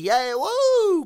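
mock cheer. Ironic cheer for [name]'s donation, high whoop. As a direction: cheer him on sarcastically.

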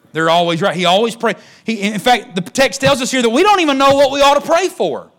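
high, loud preacher's cry; preaching at full force. Acted. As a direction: shout it out from the pulpit, rising high. Preaching climax.

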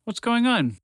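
high, distant-sounding question; calling out. Acted. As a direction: call in from outside, puzzled.

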